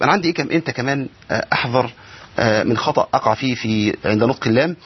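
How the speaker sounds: ordinary talk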